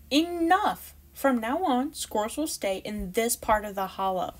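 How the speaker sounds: high, loud sharp call; fed-up mother calling order; exasperated. Acted. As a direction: put a stop to the fight. Mother stops the quarrel.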